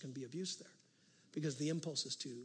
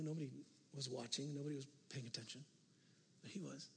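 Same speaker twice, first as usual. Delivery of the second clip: softer and softer until almost hushed; fading to hushed; 2 semitones lower, 10 dB quieter than usual. Real, not acted. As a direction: draw them in with a quiet reveal.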